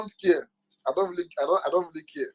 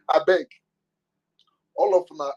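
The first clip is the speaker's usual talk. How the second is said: ordinary talk, nothing marked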